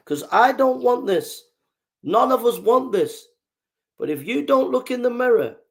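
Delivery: impassioned warning. Real, not acted. Speaker warns opponents to look in the mirror, impassioned. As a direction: push it out high and forceful.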